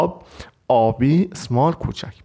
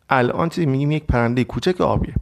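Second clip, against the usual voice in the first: ordinary delivery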